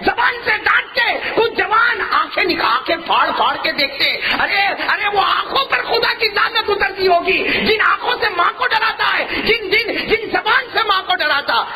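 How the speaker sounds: high, ringing shout of a fiery preacher; preaching at full force. Acted. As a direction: thunder the rebuke at the crowd. Preacher condemns sons who scare mothers.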